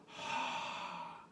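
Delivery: long soft unvoiced sigh; deep sigh. Shown as a demonstration. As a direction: let out a deep breath of a sigh. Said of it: "almost like a deep sigh".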